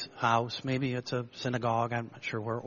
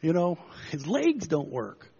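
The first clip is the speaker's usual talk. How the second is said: high, slow comic protest; flustered friends; 8 semitones higher, 6 dB louder than usual. Retold or imitated. Friends on the roof protesting to Jesus, high and comic. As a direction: squeal it like the baffled friends. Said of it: "The guys on the roof are thinking".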